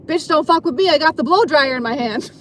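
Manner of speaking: put-on defiant outburst; mock threat. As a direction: act out a cocky challenge, loudly. Acting out defiant blow-dryer threat.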